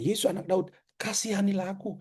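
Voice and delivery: high, hushed plea with Daud and kasihanilah lifted; pleading beggar. Retold or imitated. Preacher voices the blind man's plea, high and soft. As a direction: beg upward, softly. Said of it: "maka dia bilang".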